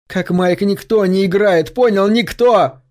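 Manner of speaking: slow, rising angry insistence. Acted. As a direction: bear down on him, building in anger. Berg angrily confronting Josh.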